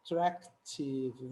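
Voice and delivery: attractive spelled out slowly, each syllable clear; slow teaching pronunciation; slow, drawn out. Shown as a demonstration. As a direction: stretch out attractive so they count the syllables. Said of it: "tem várias sílabas".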